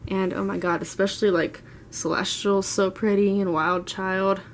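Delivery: normal talking voice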